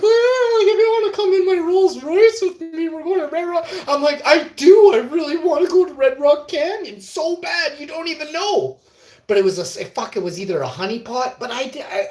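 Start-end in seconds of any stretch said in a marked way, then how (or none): 0.0-3.7 very high, loud mimicking voice; rich woman inviting. Imitating a rich woman inviting him to Red Rock, high mock voice. 4.2-8.8 high, loud gushing yes; hammed-up eagerness. Answering the rich woman's invitation, hammed-up longing. Acts his eager reply to her.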